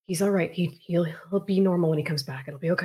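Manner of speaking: steady him with a hand on the shoulder; reassuring. Acted. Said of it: "be like".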